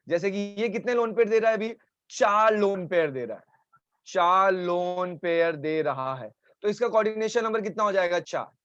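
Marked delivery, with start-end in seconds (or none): 4.1-6.3 slow, spaced-out emphatic repeat. Shown as a demonstration. Teacher repeats the answer for the class, slowly. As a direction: stretch out each word to make it sink in.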